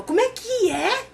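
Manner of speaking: high, loud, incredulous scolding; shocked outrage. Retold or imitated. Friend woken at night scolding him, shocked and high. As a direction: jump out of bed in disbelief and scold him.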